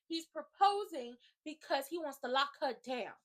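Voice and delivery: ordinary talk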